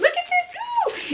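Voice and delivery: marvelling at the cosmetic case, high and slow; delighted. Real, not acted. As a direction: coo over it with delight. Excited over gift.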